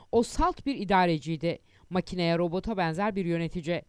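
ordinary delivery, nothing marked

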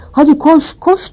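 high-pitched urgent yell to a friend; urgent shout. A narrator's reading. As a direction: cry out, leaping up in pitch. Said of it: "diye bağırdı Hermione'a".

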